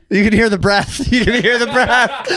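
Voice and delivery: slow, laughing through words; can barely speak for laughing. Real, not acted. Laughing at the dance demo.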